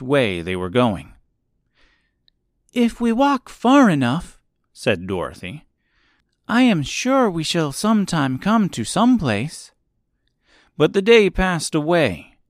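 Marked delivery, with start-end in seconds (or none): 2.7-4.4 put-on high, hopeful girl voice; Dorothy as a figure. 6.5-9.7 put-on high-pitched girl, earnest; Dorothy as a figure.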